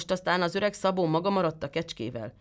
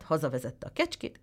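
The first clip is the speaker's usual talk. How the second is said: plain reading voice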